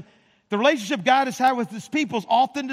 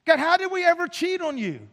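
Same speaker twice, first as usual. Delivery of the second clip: higher, indignant whining innocence; mock protesting believer; 7 semitones higher than usual. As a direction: play the offended churchgoer protesting. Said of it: "like us would say".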